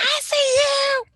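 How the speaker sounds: loud, high, drawn-out hollering; cheering scream. Acted. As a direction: holler proudly from the background. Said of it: "Screaming in the background".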